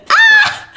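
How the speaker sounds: short, very high loud squeal; excited scream. Real, not acted. Streamer reacting to a raid, excited scream.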